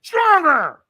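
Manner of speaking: very high, loud scream; imitated coach screaming; screamed demand. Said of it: "So he screamed at me".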